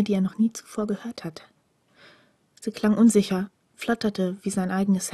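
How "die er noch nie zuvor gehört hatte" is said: plain reading voice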